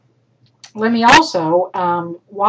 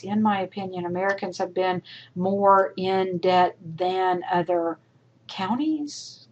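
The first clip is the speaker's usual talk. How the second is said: halting read with restarts and stumbles; confused reading; 2 semitones lower than usual. Shown as a demonstration. Employer trying to read a student's garbled sentence, confused. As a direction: stumble through it, puzzled by the wording. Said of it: "is gonna read this".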